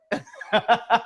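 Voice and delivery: high startled burst of laughter; flustered laugh. Retold or imitated. Caught off guard by Gary, startled laugh.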